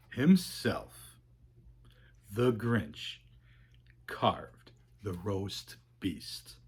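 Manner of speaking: soft, slow storybook close; hushed ending. A narrator's reading. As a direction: let the last words fall to a hush.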